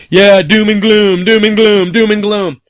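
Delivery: hammed-up prophet of doom; mock doom-monger. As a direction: parody a gloomy news site intoning doom. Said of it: "they're like".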